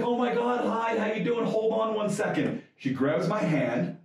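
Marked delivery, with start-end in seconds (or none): 0.0-2.6 bright, higher, excited celebrity greeting; a light take on Oprah's delighted hello; imitating Oprah. Star greeting a fan at the party, lightly imitated gush.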